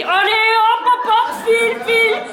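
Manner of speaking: a singsong, high shooing. Acted. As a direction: shoo her out like a hen. Shooing the wife out.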